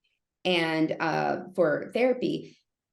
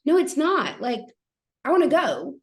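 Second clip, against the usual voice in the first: sharp, higher, louder retort; agitated; 8 semitones higher, 4 dB louder than usual. Retold or imitated. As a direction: snap back, fed up and impatient. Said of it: "I'm agitated".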